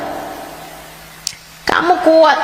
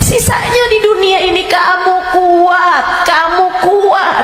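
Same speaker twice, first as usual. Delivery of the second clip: fiery preaching; 11 semitones higher than usual. Acted. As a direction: thunder the warning of the grave. Preaching about grave punishment. (ceramah berapi-api dengan suara tinggi dan keras)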